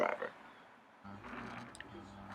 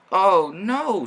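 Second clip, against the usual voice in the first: high, louder dismay; 9 semitones higher, 8 dB louder than usual. Acted. James hearing Thomas is stuck, high dismay. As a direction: gasp it out high. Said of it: "said James".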